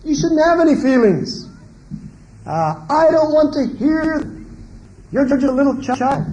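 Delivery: dismissive parent. Retold or imitated. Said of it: "that's saying to the child".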